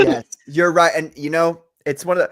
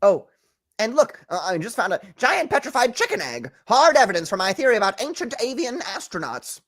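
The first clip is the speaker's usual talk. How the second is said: higher-pitched put-on accent; eager TV theorist presenting hard evidence; excitable crackpot theorist, accented; Giorgio Tsoukalos; 5 semitones higher than usual. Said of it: "Every time I have to do his accent".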